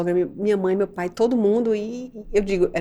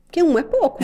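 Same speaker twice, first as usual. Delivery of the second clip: laughing, higher and louder; 9 semitones higher, 6 dB louder, faster than usual. Real, not acted. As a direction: laugh through the words.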